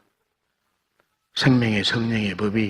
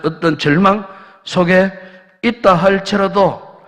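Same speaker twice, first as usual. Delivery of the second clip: building sermon climax; 5 semitones higher, 5 dB louder than usual. Acted. Pastor stirring the congregation, building to a loud climax. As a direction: start level, climb higher and louder through the list.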